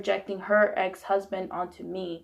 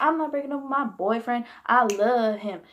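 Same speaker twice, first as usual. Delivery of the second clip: high-pitched, pleading-style insistence; retold defiant protest; 3 semitones higher, 4 dB louder than usual. Daughter standing up for boyfriend to mother, defiant. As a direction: replay your protest, starting high and landing hard on love.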